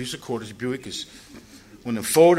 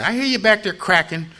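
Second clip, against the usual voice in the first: mock police call; 8 semitones higher, 6 dB louder than usual. Police heard crack pipe noise.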